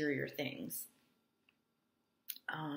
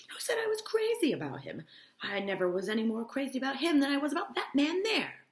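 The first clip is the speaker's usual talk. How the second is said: much higher, loud protesting voice; indignant disbelief; 10 semitones higher, 6 dB louder than usual. A narrator's reading. Myrtle protesting to Catherine, high and indignant. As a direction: shriek out the denial, offended. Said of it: "cried Myrtle incredulously".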